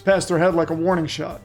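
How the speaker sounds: ordinary delivery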